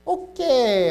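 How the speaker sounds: high, drawn-out okay, sliding down; loud, dubious retold reaction; wary okay. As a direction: act out backing off from an awkward claim. Retelling backing away from absurd claim.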